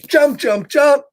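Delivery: high bouncy jump, jump, jump; excited jumping. Acted. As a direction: cheer each jump like a kid. Reading excited character jumping.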